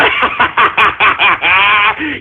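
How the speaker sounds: long deep villain cackle; evil cackle. Acted. Streamer mocking trolls with an evil cackle. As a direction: cackle like a mad overlord.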